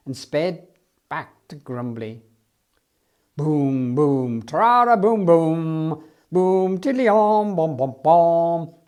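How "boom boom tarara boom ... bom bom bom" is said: louder oom-pah band noises, slowing at the end; imitated brass band. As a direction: mimic a marching band with your voice. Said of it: "The band was playing".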